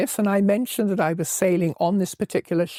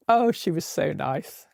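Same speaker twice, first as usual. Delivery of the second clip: usual speaking voice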